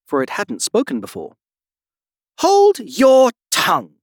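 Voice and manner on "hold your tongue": high, slow, loud rebuke; imperious shouted order; commanding cry. A narrator's reading. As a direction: cry it out, stretching each word. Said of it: "cried the Tiger Lily".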